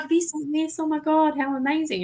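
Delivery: high, excited gushing; imitating her sister's high-pitched delight. Sister showing off a video, high excited gush. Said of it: "My sister's like".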